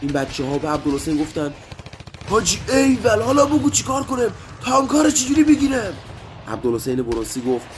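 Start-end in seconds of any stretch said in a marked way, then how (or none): 2.3-5.9 high, loud eager shout; pumped up after the win, ask for more; excited soldiers. Acted. Fighters cheer the commander.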